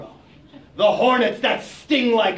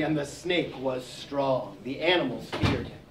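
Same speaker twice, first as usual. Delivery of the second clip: low ominous; 8 semitones lower, 8 dB quieter than usual. A narrator's reading. Describing the scary snake.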